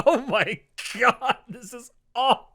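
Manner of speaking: high, loud mock dismay at a bad accent; mock horror. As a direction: act out being appalled, hammed up.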